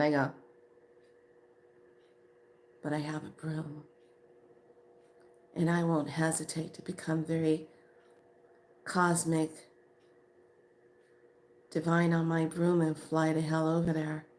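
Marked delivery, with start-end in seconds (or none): 2.8-14.3 hushed mock threat, long pauses; quiet menace. Woman threatening her friend with a broom, quietly menacing. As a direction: threaten softly, letting each phrase hang.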